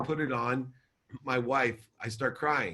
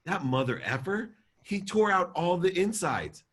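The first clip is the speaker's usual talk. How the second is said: rising, higher-pitched outrage; retold anger; 8 semitones higher than usual. Telling his wife what his dad did, angry. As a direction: re-enact the anger, climbing in pitch. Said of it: "my face turn to anger".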